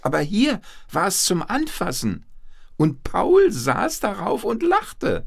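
high, thrilled storyteller voice; excited narration. A narrator's reading. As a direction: tell it as a triumphant marvel. Narrator's climax of the zebra ride. (begeistert staunend erzählen)

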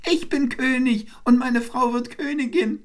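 very high, triumphant little-bird voice; calling wren. A narrator's reading. Wren claims victory over the eagle, calling out high. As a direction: crow your victory in a tiny high voice. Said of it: "rief der Zaunkönig".

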